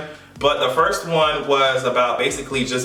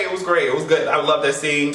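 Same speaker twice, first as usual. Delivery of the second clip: ordinary delivery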